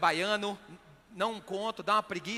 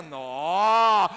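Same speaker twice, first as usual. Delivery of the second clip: impressed interjection; 4 semitones lower, 8 dB louder than usual. Acted. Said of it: "Passou uma mulher bonita".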